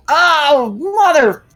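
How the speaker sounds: high, loud exasperated swearing; frustrated outburst. Retold or imitated. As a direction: blow up at a wrong answer. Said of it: "Of like".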